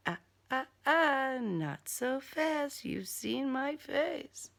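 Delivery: slow, soft warning; teasing villain. Acted. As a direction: stop her with slow menace. Killer stops her leaving.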